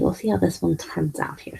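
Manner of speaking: soft whisper under her breath. Real, not acted. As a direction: whisper it to yourself. Repeats the words under her breath.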